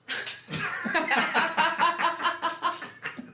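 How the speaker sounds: high, long burst of laughter; cracking up at own joke; big laugh. Real, not acted.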